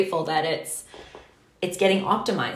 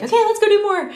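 high, louder, eager rush; eager go-getter; 6 dB louder, much faster than usual. Retold or imitated. Herself, describing her restless nature, a high eager cry. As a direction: burst out raring to go. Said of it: "my nature is to be like".

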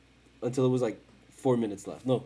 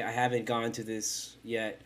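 normal talking voice

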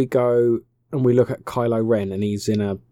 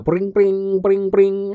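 high, loud ring-ring imitation; phone ringing; 9 semitones higher, 5 dB louder, much slower than usual. Narrator mimicking the call Rey gets, high and ringing. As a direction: chirp it like an old phone bell. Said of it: "She gets a little call".

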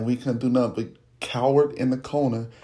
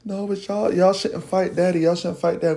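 pleading little brother; 5 semitones higher than usual. Retold or imitated.

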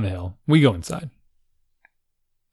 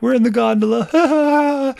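put-on high, slow, far-off girlish voice; mimicking daughter; 13 semitones higher, 6 dB louder, slower than usual. Daughter answering dad over radio, high and carefree. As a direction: drawl it like a carefree kid. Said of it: "she's like".

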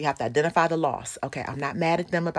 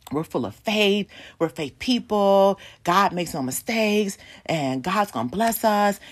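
higher, slow recited reassurances; put-on faith talk; 4 semitones higher, 3 dB louder than usual. Grieving couple reassuring each other, put-on faith talk. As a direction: recite stock church phrases, drawn out. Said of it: "What we were saying to each other".

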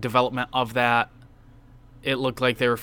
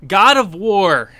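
dramatic announcement; 6 semitones higher, 10 dB louder, slower than usual. Real, not acted. Dramatic announcement of next game.

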